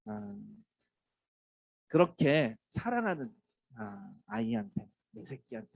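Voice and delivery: ordinary delivery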